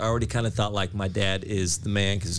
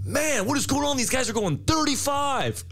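high mock irritated driver dad. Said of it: "he'd be like".

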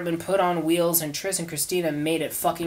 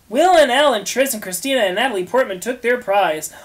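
usual speaking voice

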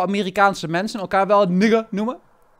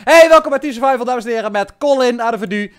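show host intro; 3 semitones higher, 6 dB louder than usual. Acted. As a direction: kick off the show with a big welcome.

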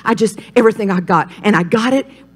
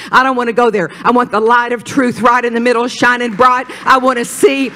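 normal talking voice

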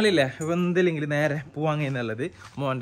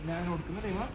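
hushed; 6 dB quieter than usual. Real, not acted. Baby asleep in car.

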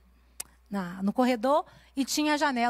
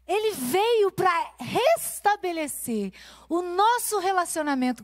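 high, pressing preacher's voice; pregação inflamada; 8 semitones higher, 3 dB louder, slower than usual. Acted. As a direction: preach it out to the women, rising. Preaching climax on the cross. (pregação em voz alta e intensa)

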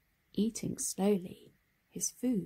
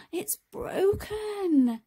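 put-on much higher, louder, slow child's wail; 11 semitones higher, 6 dB louder, much slower than usual. Harry sees his bent scooter, high dismayed wail. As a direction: stretch it out like a dismayed little boy.

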